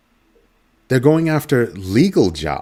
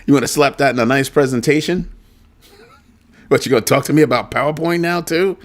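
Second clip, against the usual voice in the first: higher and louder, teasing disbelief; mocking coworker; 7 semitones higher, 3 dB louder than usual. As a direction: rub it in like he's clueless. Coworker mocking him over software.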